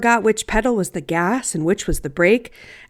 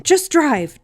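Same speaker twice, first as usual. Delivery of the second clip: sharp, higher order to herself; firm self-command; 6 semitones higher than usual. Acted. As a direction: snap yourself back to the road. Self-talk, firm then soft.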